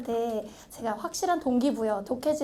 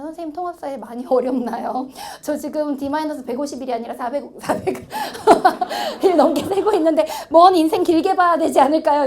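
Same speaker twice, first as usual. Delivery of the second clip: laughing student voice; 3 semitones higher, 6 dB louder than usual. Retold or imitated. Student DM read aloud, laughing.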